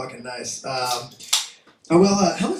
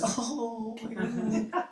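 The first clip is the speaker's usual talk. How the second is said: high, hushed adoration; touched, soft coo; 7 semitones higher, 11 dB quieter, slower than usual. Acted. Onlooker moved by an ugly couple, soft adoring coo. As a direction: melt softly with a higher pitch.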